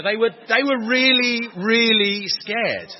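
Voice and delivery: "really, really scared" loud, high and drawn out; heavy emphasis. Real, not acted. Preacher stresses the shepherds' terror to listeners, heavy emphasis on "really, really scared". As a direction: hammer "really, really scared", stretching each word slowly.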